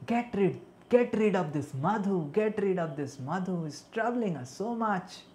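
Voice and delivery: high, loud call to a friend; Krishna shooing off a bumblebee. Acted. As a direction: call out, annoyed by the bee. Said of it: "Krishna called Madhumangal and said".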